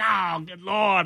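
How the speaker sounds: slow, groaning exasperated reaction; acted weary dismay; mock exasperation. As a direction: draw it out as if fed up. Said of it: "I'm like".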